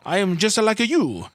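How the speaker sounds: high, loud mock Italian accent; a hammed-up Italian accent, badly faked. Peter denying his accent, in a badly faked Italian accent. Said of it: "a mockery of a bad Italian ac".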